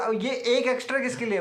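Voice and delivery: high, loud startled question about the extra one; surprised question. Retold or imitated. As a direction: ask it as if caught off guard, half-playful. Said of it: "मैंने बोला".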